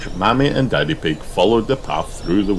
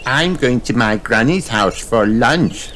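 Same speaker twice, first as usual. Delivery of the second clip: put-on high, bright little girl piggy voice; a small girl telling her plan; Peppa's voice; 5 semitones higher, 3 dB louder than usual. A narrator's reading.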